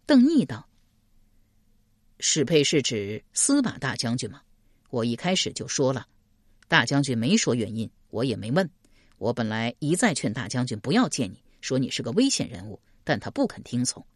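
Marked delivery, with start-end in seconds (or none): 2.2-14.0 low-pitched restrained male character; matter-of-fact official, explaining. Acted. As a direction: explain plainly in a lowered voice. 邓毅 explains himself to 史佩. (压低嗓音，如实解释)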